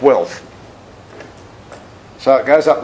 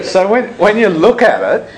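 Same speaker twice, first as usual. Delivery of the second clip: laughing; 8 semitones higher, 3 dB louder than usual. Real, not acted. Laughing at rich lady's complaint.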